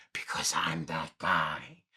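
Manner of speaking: low whispered admission; whispered, low confession. Real, not acted. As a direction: confess it under your breath. Confessing he buys steelbooks.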